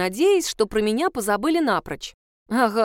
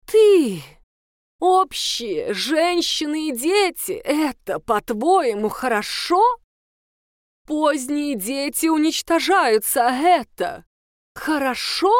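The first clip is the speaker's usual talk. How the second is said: higher and louder, slowed outrage; indignant teacher; 6 semitones higher, 4 dB louder, slower than usual. Acted. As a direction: hammer each question at the girl. Teacher berating pupil. (возмущённо, громко)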